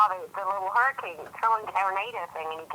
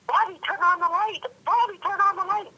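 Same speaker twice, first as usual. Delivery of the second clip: retold scream; 13 semitones higher than usual. Woman retelling how she begged her sibling for light, high mock scream. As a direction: re-enact a panicked scream an octave up. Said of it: "And I started screaming".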